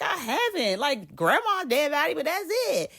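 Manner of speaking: high, whiny imitated protest; defensive protest. Janelle answering Denise about dead bodies, defensive protest.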